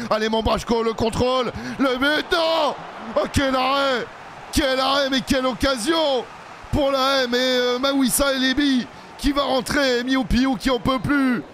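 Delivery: breathless match call, pitch soaring; excited commentary. Real, not acted. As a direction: call the near-miss like a thrilled fan. Near-goal in live match commentary.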